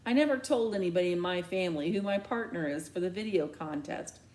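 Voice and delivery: plain reading voice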